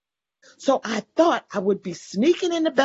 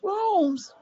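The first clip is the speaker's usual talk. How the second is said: surprised question; 11 semitones higher than usual. Retold or imitated. Surprised at rooms full of people.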